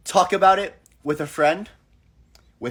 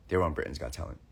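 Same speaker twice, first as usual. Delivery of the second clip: deep voice; 13 semitones lower, 11 dB quieter, faster than usual. Retold or imitated. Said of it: "They go".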